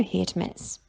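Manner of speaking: plain reading voice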